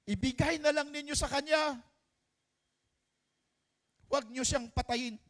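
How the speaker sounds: put-on high, pleading cry to spare the child; desperate plea. True mother begging King Solomon to spare her baby, high and desperate. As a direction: beg the king to let the baby live.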